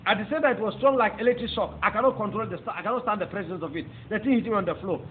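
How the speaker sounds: ordinary talk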